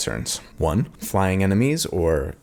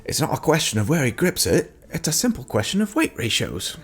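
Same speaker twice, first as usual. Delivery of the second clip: a hammed-up, higher British accent; self-mocking bad British accent; terrible British accent; 8 semitones higher than usual. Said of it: "that's my terrible British accent".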